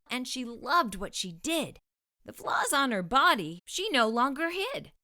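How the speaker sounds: ordinary delivery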